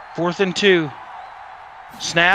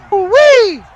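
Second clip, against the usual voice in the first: excited whoop; 11 semitones higher, 8 dB louder than usual. Real, not acted. Celebrating the touchdown.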